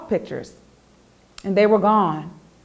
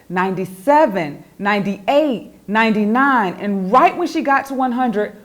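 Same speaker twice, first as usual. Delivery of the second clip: ordinary delivery, nothing marked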